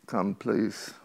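ordinary talk

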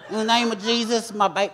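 a light echo of her high preaching; imitating Delynn. Impersonating Delynn mid-prayer, lightly voiced. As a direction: mimic the preacher's cadence, lightly. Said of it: "You know, like".

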